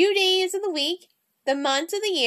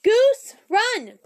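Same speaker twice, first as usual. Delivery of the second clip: urgent yell; 7 semitones higher, 6 dB louder than usual. Acted. Ghost warns Goose to flee.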